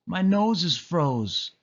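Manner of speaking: high, slow little whimper; mild whimper of a freezing puppy. A narrator's reading. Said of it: "whimpered Lucky".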